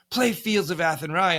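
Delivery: pushy, loud and high demand; demanding shout. Retold or imitated. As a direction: bark the request at the band. Drunk tourists demanding a song.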